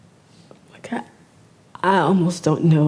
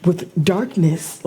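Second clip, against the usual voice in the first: ordinary talk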